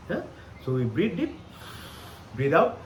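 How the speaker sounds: ordinary delivery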